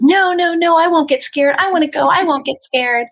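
put-on high, loud kid protest; insistent child. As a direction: argue back like a pleading child. Retold child arguing back with parents.

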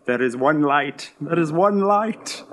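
slow, deliberate declaration, rising at the end; dramatic declaration. Acted. Reacting to light with quoted line.

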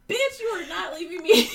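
loud, high mock protest; hammed-up sassy refusal, near laughter; outraged horse. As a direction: put your foot down, comically affronted. Voices Roach refusing to be left.

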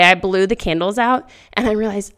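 ordinary talk

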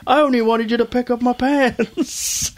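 high, whiny cartoon pig voice; exasperated shopkeeper pig. Porkrind complaining to the boys about his laundry, in a high whiny voice. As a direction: complain like a put-upon shopkeeper. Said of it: "He's like".

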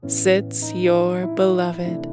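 ordinary delivery, nothing marked

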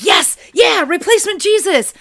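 high, loud gleeful shout; mock fan cheering; excited cheer. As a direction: cheer like your ship just sailed. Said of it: "and I'm like".

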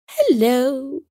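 high, lilting bird character; nightingale greeting. A narrator's reading. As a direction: answer in a high, sing-song hello. Nightingale greets the caller below.

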